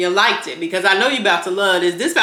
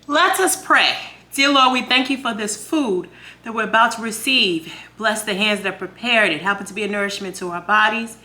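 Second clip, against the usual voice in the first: solemn family blessing. Real, not acted. Grace before eating.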